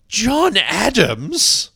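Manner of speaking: very high, loud, drawn-out British exclamation; hammed-up British monarch, slow and piercingly high; King George. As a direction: stretch it out in theatrical royal disbelief. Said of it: "the guy that plays King George".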